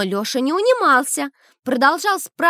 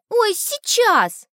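high impatient cry; 9 semitones higher, much slower than usual. Acted. Lyosha's impatient answer.

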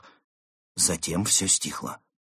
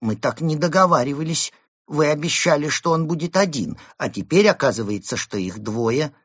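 raised, puzzled objection; bewildered protest; 7 semitones higher, 5 dB louder than usual. Acted. Bird objecting to his employers, bewildered. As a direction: protest higher and louder, thrown by the news. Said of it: "недоумевающий голос неистового птаха".

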